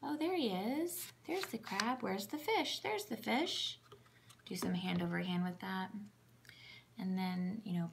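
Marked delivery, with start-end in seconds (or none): 0.0-3.8 high, sing-song parent voice; much higher, bouncy child-directed questions; talking to a toddler. Shown as a demonstration. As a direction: ask and find it like it's a game. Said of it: "you can say".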